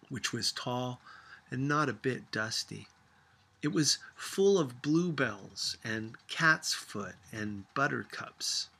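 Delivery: plain reading voice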